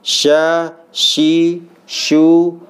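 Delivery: teacher repeating shin drill; makhraj drill. Shown as a demonstration. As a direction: drill each syllable crisply.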